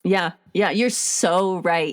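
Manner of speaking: ordinary talk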